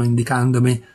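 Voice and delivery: ordinary delivery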